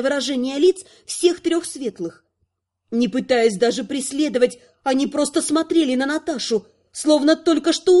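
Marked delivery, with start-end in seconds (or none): none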